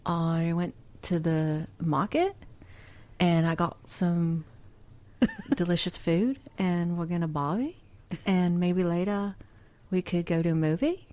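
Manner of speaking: slow, halting attempt at a Kiwi accent. Shown as a demonstration. Podcast host demonstrating how Kiwis go up at the end, tentative Kiwi accent. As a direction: Kiwi accent, phrases lifting up like questions. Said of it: "a Kiwi would be like".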